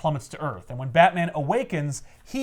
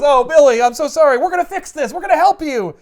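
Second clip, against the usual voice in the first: high, loud, over-earnest reassurance; hammed-up gullible hero consoling a child; gushing mock apology; 11 semitones higher, 9 dB louder than usual. Superman comforts the boy he believes in, hammed-up apology.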